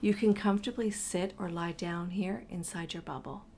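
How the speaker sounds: ordinary delivery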